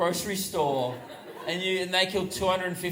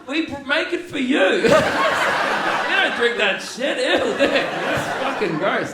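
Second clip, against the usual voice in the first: high, loud, drawn-out retort; mocking outburst; 6 semitones higher, 6 dB louder, slower than usual. Australian customer tells bartender who Foster's is for, loudly mocking. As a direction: crow it loudly at a hapless bartender. Retold gleeful jibe at bartender.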